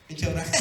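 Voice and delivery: low, soft mock chuckle; Abraham's chuckle. Preacher imitates Abraham laughing at God's promise, soft chuckling. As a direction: imitate a quiet, amused old man's laugh. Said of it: "Abraham se rió".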